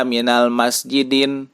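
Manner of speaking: "minal masjidin" with an n added at the end; wrong tanwin ending. Shown as a demonstration. Teacher warning against tanwin after al, "minal masjidin" with final n. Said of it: "Tidak dibaca".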